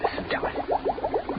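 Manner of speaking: slow, entranced whisper; slow, hushed, rapt whisper; awed whisper. Acted. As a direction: whisper it like he's listening to music. Entranced by his bubbling solvent.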